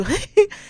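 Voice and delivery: high teasing giggle; burst of laughter. Real, not acted. Teasing the partner who laughs instead of listening, high giggle.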